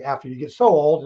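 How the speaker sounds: ordinary talk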